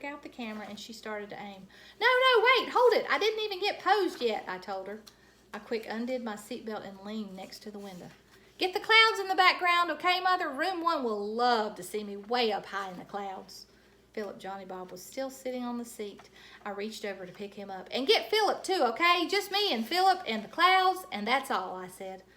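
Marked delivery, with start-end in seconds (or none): 2.0-4.4 much higher and louder, urgent; loud protest. A narrator's reading. Junie B stopping Mother from taking the picture. 8.6-13.7 higher and louder, bossy; loud eager direction. A narrator's reading. Junie B directing Mother's photo. 17.9-21.8 higher and louder, bossy; loud eager direction. A narrator's reading. Junie B directing Mother's photo.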